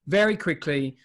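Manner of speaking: ordinary delivery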